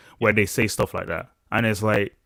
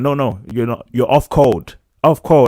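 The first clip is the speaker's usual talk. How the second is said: louder, insistent off code; mock accusing shout; 10 dB louder than usual. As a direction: bark off code at him. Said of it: "It's like".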